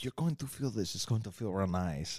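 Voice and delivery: soft mock Russian nurse; Russian accent. Nurse readies the injection, softly in Russian accent. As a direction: coax in a put-on Russian accent. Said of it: "lady's now Russian".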